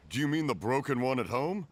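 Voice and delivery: normal talking voice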